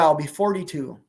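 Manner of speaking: ordinary talk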